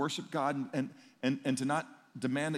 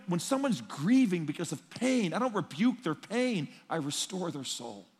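ordinary delivery

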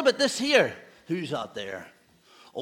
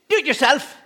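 cheeky retort; 7 semitones higher, 8 dB louder than usual. Retold or imitated. Disobedient boy talks back.

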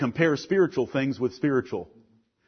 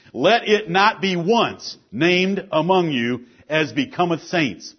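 emphatic, projected sermon delivery; forceful preaching; 10 semitones higher, 10 dB louder than usual. Real, not acted. Pastor warning the church about sin, forceful preaching. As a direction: preach it firmly, landing key words.

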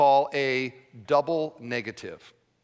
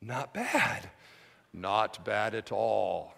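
mock understatement; 8 semitones lower, 7 dB quieter, much slower than usual. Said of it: "you're like".

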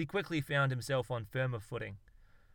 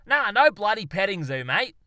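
much louder and higher Aussie scoff; exasperated outburst; 8 semitones higher, 12 dB louder than usual. Acted. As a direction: shout it down like a daft question. Darwin rejects the question.

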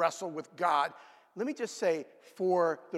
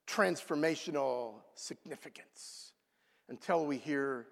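ordinary delivery

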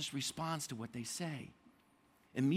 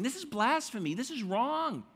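higher and louder, outraged; indignant religious leaders; 5 semitones higher, 4 dB louder than usual. Retold or imitated. Leaders of the law outraged at Jesus forgiving sins. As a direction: protest like scandalized teachers of the law. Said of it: "And they're saying".

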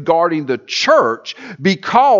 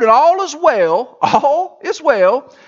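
slow, high, lingering; drawn out; 8 semitones higher, 4 dB louder, slower than usual. Acted. Preacher describing Peter on the water, slow and high. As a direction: stretch it out like everything's fine.

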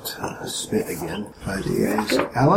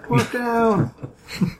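high, drawn-out cry of a casualty; high-pitched hammed-up distress call; mock alarm call. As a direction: call it out like a field medic. Mock emergency call, repeated.

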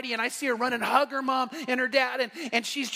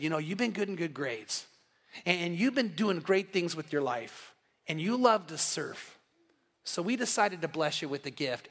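tender parents; 5 semitones lower, 4 dB quieter than usual. Retold or imitated. Proud parents telling their teenage son about his gift, softly tender. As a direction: gently build up to the surprise.

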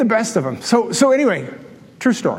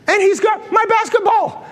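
high-pitched indignant disbelief; comically affronted storyteller; mock outrage; 12 semitones higher than usual. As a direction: squeak it out, scandalized. Indignant at seeing the stolen ball.

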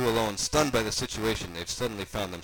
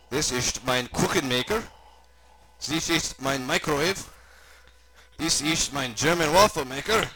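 put-on German-accented tour guide, proudly rising; German host presenting each gadget; German doctor character; 5 semitones higher, 5 dB louder than usual.

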